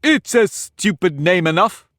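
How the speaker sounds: impatient egg interrupting Alice. A narrator's reading. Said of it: "interrupted impatiently".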